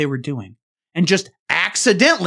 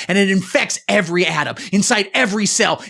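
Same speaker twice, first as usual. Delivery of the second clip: ordinary talk, nothing marked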